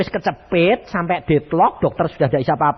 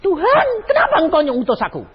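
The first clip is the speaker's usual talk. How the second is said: Moses crying out; 12 semitones higher, faster than usual. Retold or imitated. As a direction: cry out at God. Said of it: "Musa meneriak".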